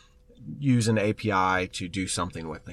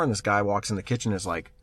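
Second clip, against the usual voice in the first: ordinary talk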